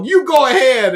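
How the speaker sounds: loud, high, fast and breezy; cheerful announcement. Retold or imitated. The survivor telling everyone he's off to the kitchen, breezy and loud. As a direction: toss it off like nothing happened. Friends letting him go.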